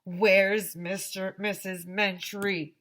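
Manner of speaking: loud, slow group-style yell. A narrator's reading. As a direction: holler it drawn out, like a whole crowd. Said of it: "everybody yelled".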